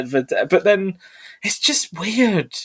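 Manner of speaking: usual speaking voice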